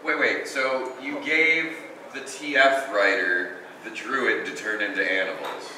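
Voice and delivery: normal talking voice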